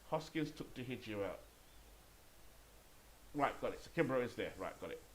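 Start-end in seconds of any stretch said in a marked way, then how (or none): none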